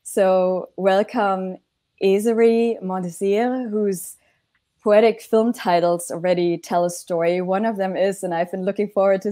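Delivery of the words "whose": usual speaking voice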